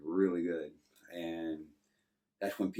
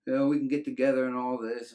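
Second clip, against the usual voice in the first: higher, louder mock-inviting woman; flirty offer; 6 semitones higher, 6 dB louder than usual. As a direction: tease him with an offer, hammed-up. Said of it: "She's like".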